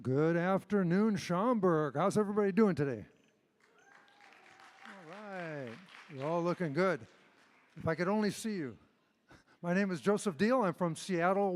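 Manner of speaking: ordinary delivery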